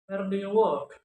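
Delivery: loud demonstration of "Where do you work?", falling at the end; question intonation. Shown as a demonstration. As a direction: hit "work" loudly, then drop.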